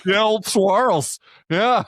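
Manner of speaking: high, slow, drawn-out nickname; playful drawl. Real, not acted. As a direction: savor the nickname slowly.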